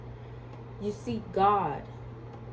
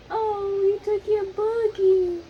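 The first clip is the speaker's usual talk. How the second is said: high, louder pretend wail; mock crying baby talk; 13 semitones higher, 5 dB louder than usual. Mother hamming a fake wail to the baby. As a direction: wail along in fake sympathy. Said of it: "your fake tears".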